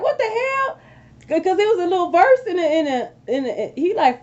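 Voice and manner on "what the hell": much higher, stunned "What the hell?"; shocked disbelief. Retold or imitated. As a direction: yelp it out in disbelief. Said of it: "I'm like".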